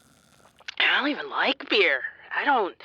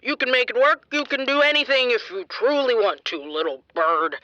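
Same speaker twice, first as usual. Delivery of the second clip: normal talking voice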